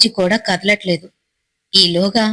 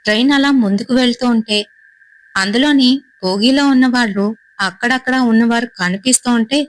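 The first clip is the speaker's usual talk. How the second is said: usual speaking voice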